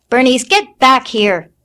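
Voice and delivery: loud sharp order. A narrator's reading. Mother ordering older sister back, loud. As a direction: call the sister back firmly. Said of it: "Leffie ordered".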